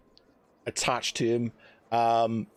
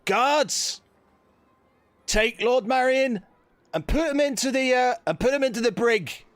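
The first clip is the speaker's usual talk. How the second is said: high, raised commanding call; captain's command; 13 semitones higher, 4 dB louder than usual. Acted. Captain ordering guards to jail Lord Marion, commanding call.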